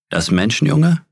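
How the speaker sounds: a bear's astonished repeat, pitch climbing; surprised question. A narrator's reading. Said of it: "sagte er".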